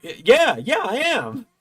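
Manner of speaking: high-pitched excited stammer; starstruck. Retold or imitated. Fan answers his idol who recognised him, starstruck. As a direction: re-enact a giddy fan, voice leaping up.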